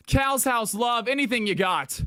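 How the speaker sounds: usual speaking voice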